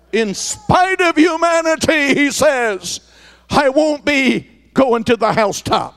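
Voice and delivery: rising, shouted praise, slowed on key lines; preaching at full force. Acted. Pastor preaching to the congregation, full force. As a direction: lift the church with a call and answer.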